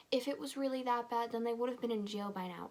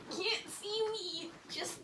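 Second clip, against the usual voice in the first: sneaky sing-song; 9 semitones higher, slower than usual. Pretend. Sneaking away, playful self-narration.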